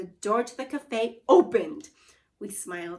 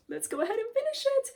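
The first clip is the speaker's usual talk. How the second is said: excited; 12 semitones higher, faster than usual. Real, not acted. Teacher rallies class.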